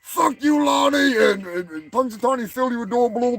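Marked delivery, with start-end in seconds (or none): 0.0-1.2 higher yelled curse; hammed-up yell, higher; shout. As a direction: throw it at Lonnie again.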